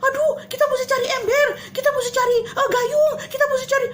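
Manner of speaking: high, loud, hammed-up fluster; mock flustered Jesus. Teacher jokes Jesus fusses for a bucket, hammed-up panic. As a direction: play a panicked bailer for laughs. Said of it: "apakah tiba-tiba Tuhan Yesus bilang".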